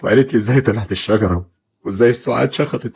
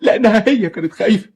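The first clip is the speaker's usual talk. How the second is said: high, raised accusing voice; bitter anger; 11 semitones higher than usual. Acted. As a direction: lash out at her cruelty. Father's angry accusation.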